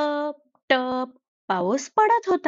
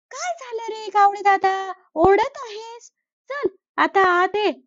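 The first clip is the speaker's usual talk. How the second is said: higher, friendly sparrow voice; kind sparrow; 8 semitones higher than usual. Acted. Sparrow opens the door to the shivering crow, higher and kind. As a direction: welcome him in warmly.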